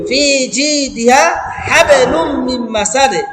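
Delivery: slow melodic Quranic chant; Quran recitation. Shown as a demonstration. Teacher reciting the verse to students, melodic chant.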